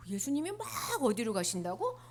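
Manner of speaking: ordinary delivery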